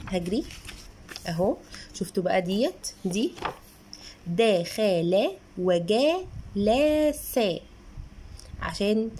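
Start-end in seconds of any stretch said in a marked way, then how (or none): 4.3-7.4 very slow, syllable by syllable; child's careful reading. Shown as a demonstration. Mother showing how a child reads the book, drawn-out sounding out. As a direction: sound out each syllable like a beginner reader. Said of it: "الطفل يقرأ".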